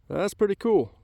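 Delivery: high, excited exclamation; thrilled at the find. Real, not acted. Excited find of arrowhead point.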